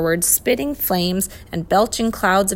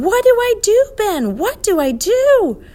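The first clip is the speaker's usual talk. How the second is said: high, loud shout for help; raised, high-pitched panicky shout; 7 dB louder than usual. A narrator's reading. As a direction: shout frantically to a friend over engine noise. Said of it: "Tom was shouting".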